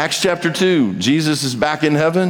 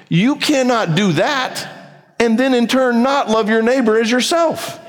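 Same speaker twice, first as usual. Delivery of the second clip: high, impassioned preaching; preaching at full force; 8 semitones higher than usual. Real, not acted. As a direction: drive it home from the pulpit.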